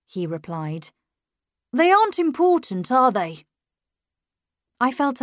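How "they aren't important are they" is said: higher, louder tag question; challenging boy. A narrator's reading. Miles challenging the governess, higher challenging boy's voice. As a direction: press the point, pitched up.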